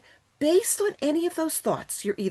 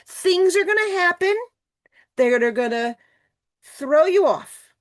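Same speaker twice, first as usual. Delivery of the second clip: dramatic build; 7 semitones higher, 4 dB louder than usual. Shown as a demonstration. Dramatizing life throwing you off.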